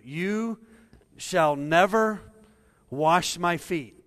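loud, slow stress on never; emphatic. Retold or imitated. Peter refusing Jesus, loud slow emphatic. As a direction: hit shall never wash my feet hard and slow. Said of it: "Very emphatic".

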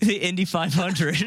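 laughing through the words; cracking up mid-sentence. Real, not acted. Cracking up at joke.

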